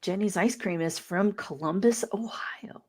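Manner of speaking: unvoiced whispered aside; whispering. Real, not acted. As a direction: whisper it softly.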